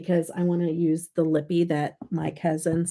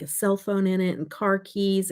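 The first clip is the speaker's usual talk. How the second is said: ordinary talk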